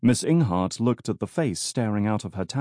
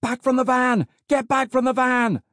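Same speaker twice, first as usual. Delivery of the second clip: high, loud police shout; 5 dB louder than usual. A narrator's reading. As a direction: yell a warning at full pitch.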